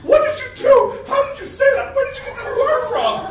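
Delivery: very high, loud hysterical scolding; mom freaking out. Retold or imitated. Mother catching her son swearing, freaked-out high yelling. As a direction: shriek the questions as a scandalized mother. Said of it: "completely starts freaking out".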